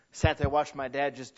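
normal talking voice

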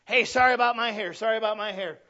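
hammed-up anxious apologizer; overly apologetic. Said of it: "they're always overly apologetic".

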